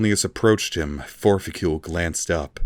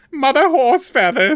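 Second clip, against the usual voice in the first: much higher, louder blurted name; Forficule voice; 7 dB louder than usual. A narrator's reading. The elf blurts the name to his torturer, high and loud. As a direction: give it up in fear.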